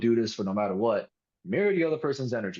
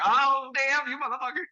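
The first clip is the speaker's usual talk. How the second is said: mock shock; 13 semitones higher than usual. Reacting to crude answer.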